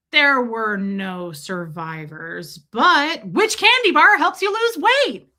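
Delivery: mock newscaster jumping from tragedy to fluff; news anchor. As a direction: parody anchorman tone shift. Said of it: "I'm like a news anchor".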